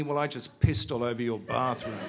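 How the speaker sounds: sneering line ending in a laugh; a mocking snigger after the boast; gloating laugh. Bunny gloats, laugh heard.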